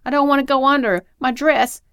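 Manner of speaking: high, loud cry of protest; panicked yelling. A narrator's reading. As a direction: yell as if about to go under. Struggling in the lake, yelling.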